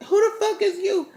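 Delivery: mimicked girlfriend, high and confrontational; outraged demand. Girlfriend confronting a stranger in bed, mimicked outraged demand.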